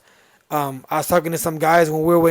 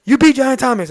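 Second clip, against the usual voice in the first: high, loud burst of disbelief; astonished question; 9 semitones higher, 8 dB louder than usual. Retold or imitated. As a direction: imitate someone amazed at the news. Rival astonished he won.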